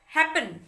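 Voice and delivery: Happen with the first syllable है stressed. Shown as a demonstration. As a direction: lean on है in happen, let पन fall away. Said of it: "पहले वाले syllable पे आ रहा है".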